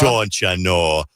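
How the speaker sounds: drawn-out Minnesota "don't you know"; slow, put-on Minnesota accent. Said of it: "gonna do the Minnesota Accent Challenge".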